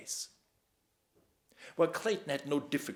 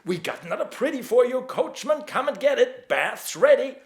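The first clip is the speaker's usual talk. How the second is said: taunting yell; 12 semitones higher, 13 dB louder than usual. A narrator's reading. Drunk boys taunting, high loud.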